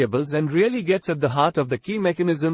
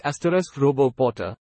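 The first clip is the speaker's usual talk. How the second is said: normal talking voice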